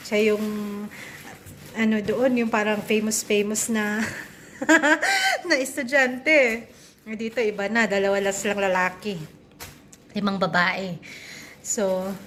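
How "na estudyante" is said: laughing. Real, not acted. Laughing while describing son.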